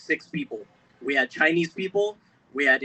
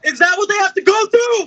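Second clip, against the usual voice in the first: much higher, louder outraged yell; angry shout; 14 semitones higher, 7 dB louder than usual. Real, not acted. Speaker demands of audience about his future kid, furious shout. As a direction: cry it out to the crowd in real anger. Repeated question, now shouted.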